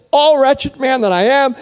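quoting Paul's anguished cry back at him. Retold or imitated. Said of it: "You just said".

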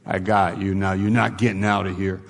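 ordinary talk, nothing marked

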